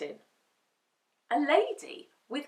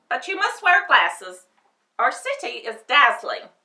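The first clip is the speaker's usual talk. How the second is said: high, loud gatekeeper voice; 6 semitones higher, 11 dB louder than usual. A narrator's reading. Gatekeeper tells Dorothy about the palace, loud and high. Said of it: "said the man".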